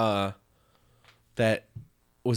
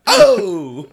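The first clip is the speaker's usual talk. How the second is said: very high, loud mock New York "oh"; New York accent; 10 dB louder than usual. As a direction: squeal out a joke New York exclamation. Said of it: "This is my New York".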